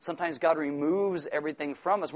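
usual speaking voice

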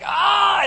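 high, loud pained yell; hammed-up cry of strain; intense cry. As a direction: burst out in acted agony. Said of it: "just intense".

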